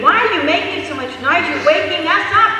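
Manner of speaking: loud, high, fast angry complaint; acted outraged shouting; screaming neighbor. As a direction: yell through the wall at noisy neighbors.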